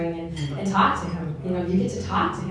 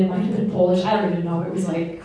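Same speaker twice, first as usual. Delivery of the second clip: usual speaking voice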